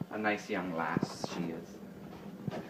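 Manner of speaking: slow, whispered fond aside; a slow secret whisper about Rachel. Real, not acted. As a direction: lean in and whisper it slowly.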